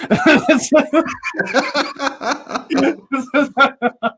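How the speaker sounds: high burst of laughter. Real, not acted. Laughing at video.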